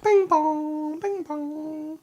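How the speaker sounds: high, sung two-tone doorbell chime. Retold or imitated. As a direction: imitate an electronic doorbell ding-dong. GM imitating doorbell again.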